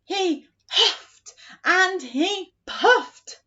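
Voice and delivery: hammed-up wolf huffing; wolf huffing and puffing. Shown as a demonstration. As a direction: blow the words out like the wolf. Acting out the wolf.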